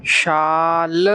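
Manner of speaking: शाल with the aa held very long and slow; stretched long aa. Shown as a demonstration. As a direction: drag out the aa in शाल for the class. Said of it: "उसे देर तक बोलते हैं". (आ को बहुत लंबा खींचकर शाल)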